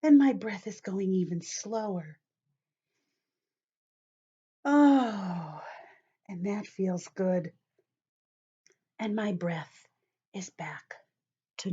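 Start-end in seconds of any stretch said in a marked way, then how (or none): none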